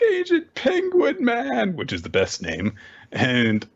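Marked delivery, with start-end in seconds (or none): 0.0-1.8 put-on slow, high-pitched child cry; drawn-out childish lament; distressed little girl. Child looking at her torn penguin, high wail. Acted child addressing toy.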